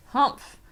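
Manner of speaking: loud disdainful humph; indignant kangaroo humph; scornful humph. A narrator's reading. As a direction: snort with contempt. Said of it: "humphed a voice".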